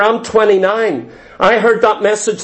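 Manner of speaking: normal talking voice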